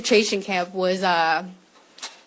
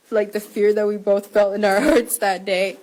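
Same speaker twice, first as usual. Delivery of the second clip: real tears breaking through while speaking; choked-up sob mid-sentence; 4 dB louder than usual. Real, not acted. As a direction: voice cracking into a tearful laugh-sob. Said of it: "I'm getting a little emotional".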